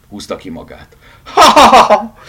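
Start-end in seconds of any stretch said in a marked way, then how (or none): 1.2-2.2 loud, high guffaw; unrestrained mocking ha-ha; uproarious laughter. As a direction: laugh your head off. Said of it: "hahotázott önfeledten Húzós".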